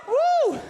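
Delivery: very high, loud "woo!"; rallying whoop. Real, not acted. Cheering for fellow thigh chafers.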